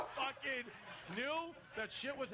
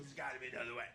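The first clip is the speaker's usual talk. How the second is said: lower, gruff repeat of the plea; low gruff dad; 9 semitones lower, much faster than usual. Retold or imitated. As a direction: growl the plea like a tough father. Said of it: "My dad goes".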